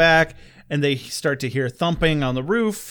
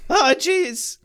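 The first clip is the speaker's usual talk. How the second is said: high Morty-style whine; 11 semitones higher than usual. Pretend. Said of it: "they're both voiced by Morty".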